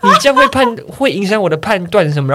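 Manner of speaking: high, loud mock lecture; mock stern dentist; scolding dentist. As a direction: scold the patient. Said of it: "他说".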